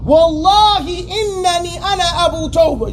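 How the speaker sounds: every word pressed hard; emphatic oath. Shown as a demonstration. Teacher voicing a speaker who insists on his name. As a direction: swear it with full emphasis. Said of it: "now I went to the ta'keed".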